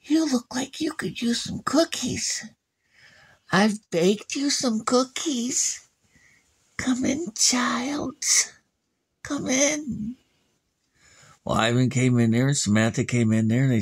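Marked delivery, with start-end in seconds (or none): 0.0-10.2 put-on high, coaxing old lady voice; sweet old witch. Old witch luring the children inside, high and coaxing. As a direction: lure them in sweetly like a witch.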